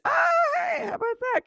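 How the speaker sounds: high-pitched delighted "ah, hey"; excited squeal. Real, not acted. Streamer squealing at a viewer's long watch, delighted. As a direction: squeal with mock surprise at the milestone. Reacting to 300 minutes watched.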